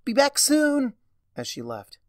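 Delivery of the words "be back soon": high, loud call while running off; loud parting shout. A narrator's reading. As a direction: shout it over your shoulder as you leave.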